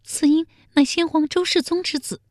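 very high, small maid voice; timid palace maid. A narrator's reading. Palace maid confessing the baby's father to the emperor, timid. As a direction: answer meekly in a high-pitched woman's voice. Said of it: "宫女小声回道".

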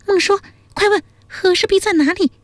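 high-pitched princess giving an angry order; high character voice, indignant and demanding. A narrator's reading. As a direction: voice an irate princess, pitched far up without shouting. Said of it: "江米大怒说".

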